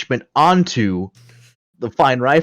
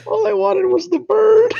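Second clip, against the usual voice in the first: high plaintive wail; slower than usual. Acted. Character's plaintive line after the fight.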